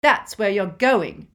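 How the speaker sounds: loud, slow gloating; with relish. A narrator's reading. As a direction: savour the taunt, loud and drawn out. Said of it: "said Petunia with relish".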